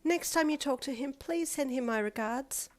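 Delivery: high, slow sweet voice; lightly voiced sweet girl. A narrator's reading. Momo character voice.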